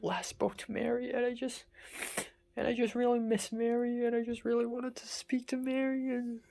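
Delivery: high, soft, close to tears; tearful longing. Real, not acted. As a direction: let the voice go small and high with longing. Breaking down over missing Mary.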